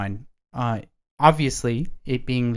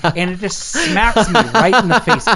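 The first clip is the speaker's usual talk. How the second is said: laughing; 8 semitones higher, 6 dB louder than usual. Real, not acted. Laughing while telling mishap.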